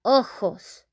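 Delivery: ojos with a breathy h for the j, o-hos; Spanish ojos. Shown as a demonstration. Teacher modeling ojos for learners, clear Spanish. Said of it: "we say".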